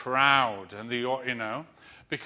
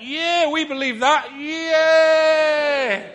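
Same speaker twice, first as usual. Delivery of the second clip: much higher, much louder excited yell; crowd cheer; 12 semitones higher, 11 dB louder, much slower than usual. Retold or imitated. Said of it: "they all went".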